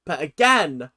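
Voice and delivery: ordinary delivery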